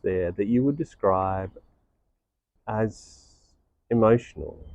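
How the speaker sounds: guided meditation. Real, not acted. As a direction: guide listeners inward, unhurried and hushed.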